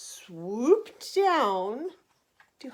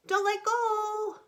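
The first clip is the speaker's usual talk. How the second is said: mild warning; 9 semitones higher, 3 dB louder, slower than usual. Acted. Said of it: "warned mother".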